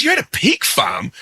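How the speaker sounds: high mock disbelief at a pig farm; disbelieving echo. Radio host to pig farmer caller: high amused disbelief. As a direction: echo it back like it's absurd.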